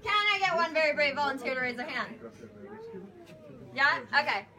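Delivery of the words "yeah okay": ordinary talk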